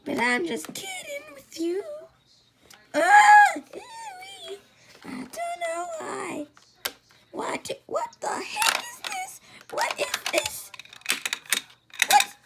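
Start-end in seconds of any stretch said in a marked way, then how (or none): 2.9-12.4 squeaky high voice. Pretend.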